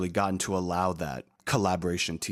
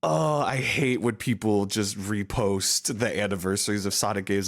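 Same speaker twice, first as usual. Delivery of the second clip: ordinary talk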